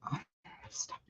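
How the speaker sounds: breathy whispered aside, almost silent; whispered stop. Real, not acted. Reading comments, she whispers to herself in disbelief. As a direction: mutter it under your breath in disbelief.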